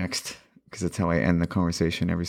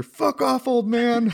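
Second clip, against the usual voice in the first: put-on much higher, snotty kid voice telling the old man off; rude young brush-off; 4 dB louder, much slower than usual. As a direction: sneer it like a bratty teenager. Younger self snaps back rudely.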